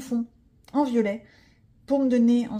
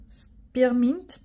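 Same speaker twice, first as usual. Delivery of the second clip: English word in French accent; 2 semitones higher, 4 dB louder than usual. Shown as a demonstration. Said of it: "mon accent est magnifique".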